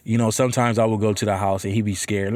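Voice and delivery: ordinary talk, nothing marked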